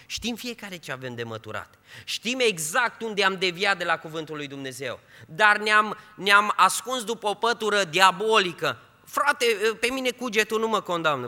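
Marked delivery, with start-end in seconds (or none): 2.0-11.3 louder, higher rebuke from the pulpit; fervent preaching. Acted. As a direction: rise up and rebuke. Preaching surge. (predică aprinsă, mai tare și mai sus)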